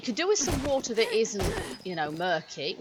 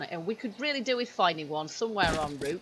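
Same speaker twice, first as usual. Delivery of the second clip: normal talking voice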